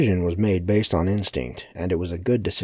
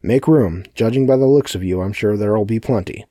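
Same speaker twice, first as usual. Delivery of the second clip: raised, louder voice ordering a crowd; commanding announcement; 4 semitones higher, 7 dB louder than usual. Acted. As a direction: herd the room like a loud master of ceremonies. Villain orders the crowd about.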